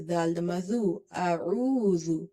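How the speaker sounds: usual speaking voice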